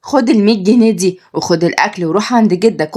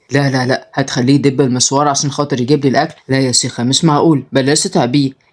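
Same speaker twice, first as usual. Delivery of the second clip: put-on old father voice; 5 semitones lower than usual. Old father talks to his daughter on the phone, low voice. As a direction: speak like a wistful grandfather. Grandfather declines.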